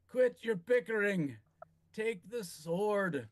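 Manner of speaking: dying lord barks at bickering heroes; stern command. Acted. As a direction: snap them out of arguing. Lord scolds then orders.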